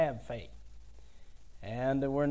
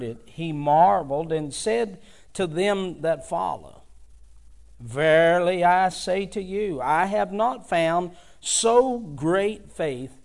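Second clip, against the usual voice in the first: a rising, louder King James reading with preacher's force; fervent Bible reading, louder at the commands; scripture reading; 2 semitones higher, 3 dB louder than usual. Acted. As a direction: proclaim the centurion's and Jesus' words to the church. Reads the scripture text aloud.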